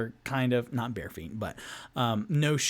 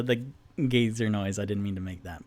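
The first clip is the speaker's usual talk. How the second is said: ordinary talk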